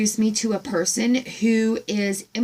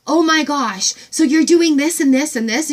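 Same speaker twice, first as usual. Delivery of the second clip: higher and louder rapid excited questions; excited gush; 5 semitones higher, 4 dB louder than usual. Retold or imitated. Retelling meeting the farm woman, gushing excitedly. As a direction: gush like you just met a kindred spirit. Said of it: "it was just like".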